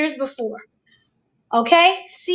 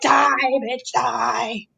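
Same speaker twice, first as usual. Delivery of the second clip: mock scream; 3 semitones lower, much slower than usual. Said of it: "[name] is screaming".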